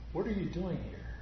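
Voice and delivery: soft, hushed voice of God; low whisper. Retold or imitated. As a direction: murmur it gently like a still small voice. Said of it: "that low whisper".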